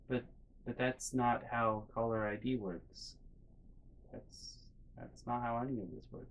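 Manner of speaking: small, soft, halting retort; meek objection. Retold or imitated. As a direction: mumble a timid correction, imitating yourself cowed. Meek objection to screaming mom.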